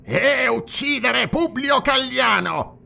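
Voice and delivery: high, slow, strained cry; outraged high-pitched shout; accusing exclamation. A narrator's reading. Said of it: "esclamò Dionus".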